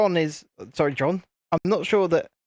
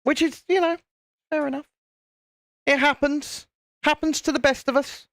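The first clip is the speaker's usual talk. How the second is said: much higher, sing-song put-on voice; squeaky mock voice; 11 semitones higher than usual. Streamer answering himself in a squeaky mock voice. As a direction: pipe it up high like a cartoon.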